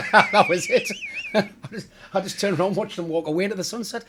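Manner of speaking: talking through real laughter; laughing. Real, not acted. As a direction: laugh your way through the punchline. Laughing at Ken's curt advice.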